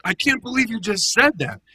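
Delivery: sudden high, loud What; high priest hearing blasphemy, outraged; outraged disbelief. Retold or imitated. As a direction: burst out in shock. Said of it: "He's like".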